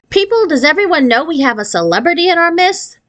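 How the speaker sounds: gushing teacher announcement, pitched well up; teacher beaming at the class, bright and lifted. Acted. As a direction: proudly show off the star pupil.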